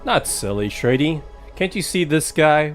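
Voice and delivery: ordinary talk, nothing marked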